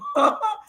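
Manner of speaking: high-pitched scornful laugh; high laugh of disbelief; derisive laugh. Real, not acted. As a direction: burst into high, incredulous laughter. Laughs at supposed concern.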